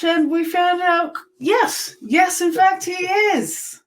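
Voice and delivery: excited. Real, not acted. Big family reveal of heritage.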